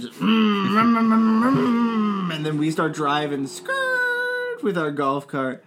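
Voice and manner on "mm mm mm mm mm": rising mm engine revs; engine revving. Pretend. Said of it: "I start revving the engine".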